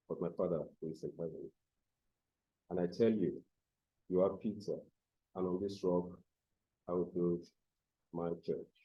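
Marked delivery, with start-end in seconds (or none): none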